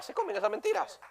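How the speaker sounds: high, soft scorn; a high, soft sneer; scornful aside. Real, not acted. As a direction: dismiss it with contempt. Scornful aside after mock quote.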